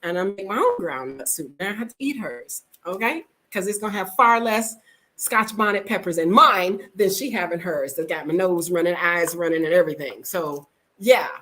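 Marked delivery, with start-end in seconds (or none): none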